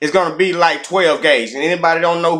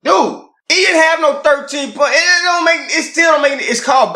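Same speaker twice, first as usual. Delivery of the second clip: high exasperated protest; 11 semitones higher than usual. Real, not acted. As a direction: voice jumps high in disbelief. Heated disagreement about quarterback.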